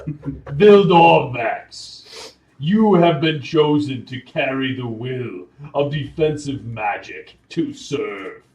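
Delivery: very loud, drawn-out proclamation; booming voice. Acted. Game master repeating the saying for the player, booming. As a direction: thunder out the name, stretching it long. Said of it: "you hear a booming voice".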